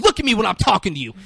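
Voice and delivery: fast, high, loud angry command; acted screaming. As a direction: shout it like a sudden explosion. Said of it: "he's just fucking screaming".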